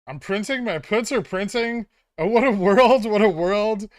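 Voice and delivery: high, loud elated outburst; rapid hyped trader panic. Real, not acted. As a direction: crow with delight. Puts printing, elated streamer.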